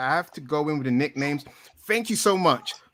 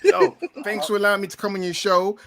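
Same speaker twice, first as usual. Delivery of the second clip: ordinary talk, nothing marked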